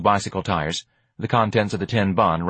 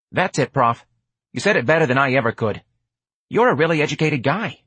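higher, quick street voice; pitched-up, admiring patter; streetwise hustler, flattering; 6 semitones higher than usual. Acted. George flatters the educated Prof, street-kid voice.

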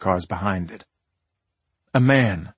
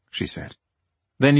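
plain reading voice

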